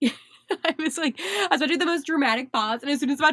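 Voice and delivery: giggling through the words; amused, laughing aside. Real, not acted. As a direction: talk while still laughing. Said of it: "I began laughing".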